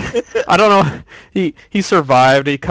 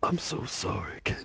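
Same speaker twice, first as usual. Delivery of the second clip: very soft, lower mock apology; bad game impression; 4 semitones lower, 16 dB quieter than usual. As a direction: murmur a hammed-up apology. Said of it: "The impressions are getting worse and worse".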